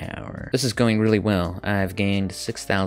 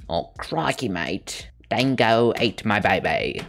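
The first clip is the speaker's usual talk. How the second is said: put-on Australian accent; 2 semitones higher than usual. Australian stereotype after landing Australia.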